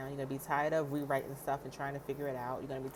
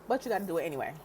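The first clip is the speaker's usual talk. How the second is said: ordinary delivery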